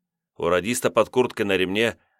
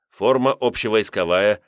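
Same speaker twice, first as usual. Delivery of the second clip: ordinary delivery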